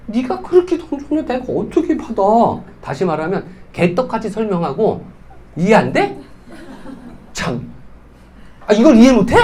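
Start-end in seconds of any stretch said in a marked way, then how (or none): 0.1-2.2 higher-pitched put-on voice of an offended prospect; indignant prospect. Prospect complaining to the distributor, indignant put-on voice. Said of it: "화는 원래 이 사람이 내는 거잖아". 8.7-9.4 louder, laughing scoff of a condescending sponsor; mocking condescension. Shown as a demonstration. Sponsor scoffing at a new member, laughing condescension. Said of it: "이렇게 한다는 거예요".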